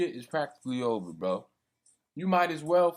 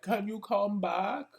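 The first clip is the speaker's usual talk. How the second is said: higher, slow, mock pleading; mocking whiny plea; 7 semitones higher, slower than usual. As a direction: parody their begging, drawn out. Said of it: "these bitches are gonna be like".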